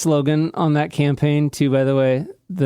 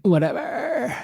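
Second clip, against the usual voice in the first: put-on higher, bored valley-girl whine; mock Kardashian; 12 semitones higher than usual. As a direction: lightly sneer like a spoiled celebrity. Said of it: "that's a Kardashian thing".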